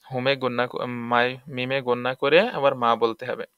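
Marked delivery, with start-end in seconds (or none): none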